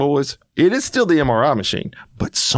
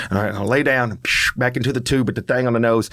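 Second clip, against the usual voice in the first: normal talking voice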